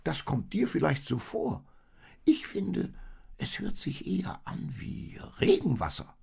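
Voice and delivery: high-pitched contrary sheep voice; sceptical sheep. A narrator's reading.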